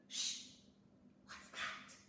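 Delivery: soft unvoiced whisper; whispered alarm; hushed whisper. A narrator's reading. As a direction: hush and listen for the sound. Hushing, hearing a noise.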